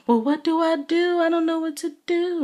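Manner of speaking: mock her helpless whining; mocking Candy. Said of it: "And Candy still carry on like".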